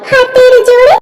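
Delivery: ordinary talk, nothing marked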